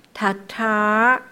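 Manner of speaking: second 塔 drawn out with a curled tail; lengthened sound. Shown as a demonstration. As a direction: stretch the last vowel of 塔塔. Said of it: "呃的音拉长了".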